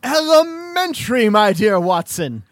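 high, loud, badly faked British sleuth; yelling British accent. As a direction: shout it in mock-British. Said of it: "He's just yelling".

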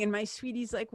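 normal talking voice

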